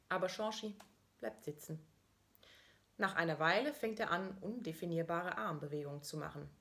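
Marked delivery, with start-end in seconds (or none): none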